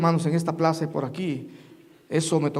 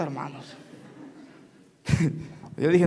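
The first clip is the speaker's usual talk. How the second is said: normal talking voice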